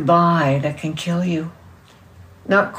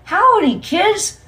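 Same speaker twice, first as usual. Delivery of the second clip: very high, loud kiddie-show call; TV puppet greeting; 7 dB louder, slower than usual. A narrator's reading. Howdy on TV greeting the kids, high and loud. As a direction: holler it like a children's show host, pitched way up.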